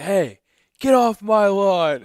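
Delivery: high, loud, slow mock yell of a cranky old man; grumpy old man. Retelling the ad's old man chasing kids off, hammed-up grumpy yell. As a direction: holler it off the porch, hammed up.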